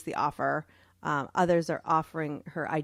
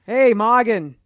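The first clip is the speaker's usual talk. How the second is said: calling out; 4 semitones higher, 13 dB louder, slower than usual. Pretend. Calls out her old name.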